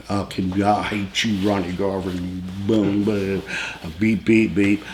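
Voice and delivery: low muttered cussing with bleeped-out swears; mock cussing. Cussing out his pilot, low muttered curses. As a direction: grumble curses under the breath. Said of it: "I'm cussing out every breath".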